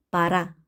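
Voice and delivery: 빠라 with first o said as a; Russian 빠라, unstressed o opened to a. Shown as a demonstration. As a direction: say 빠라, stress on the final a. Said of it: "강세 없는 오는 아로 읽는다".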